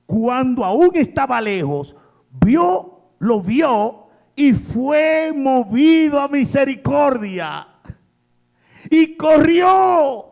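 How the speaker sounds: high, loud, fervent preacher's cry; rising preacher's shout, slowing on the last words; full-force preaching. Acted. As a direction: preach it out to the whole congregation. Preaching the prodigal's father.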